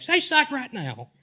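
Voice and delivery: high, slow Southern drawl on Say 'psych' right now; Southern accent. Retold or imitated. Creator showing fans his Southern accent, hammed-up drawl. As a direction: ham up a Southern accent for the camera. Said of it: "putting on the, the Southern accent".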